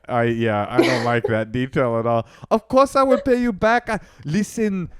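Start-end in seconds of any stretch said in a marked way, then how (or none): 2.5-4.9 mock French boyfriend, pitched higher; a hammed-up French accent, begging. Mocking the French boyfriend asking for money, higher French accent. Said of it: "I did the French accent".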